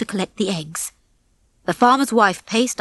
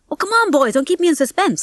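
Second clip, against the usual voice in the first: higher-pitched, impatient coaxing; eager Rocky; 8 semitones higher than usual. A narrator's reading. Rocky asks Nick and Fetcher for news, eager. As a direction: press the boys for the story.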